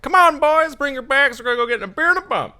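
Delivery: very high, loud, rushed whoop; rally everyone in a shrieky prospector holler; hollering dad. Retold or imitated. Co-host imitating host's dad greeting the boys, high loud holler. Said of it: "your dad goes is just like".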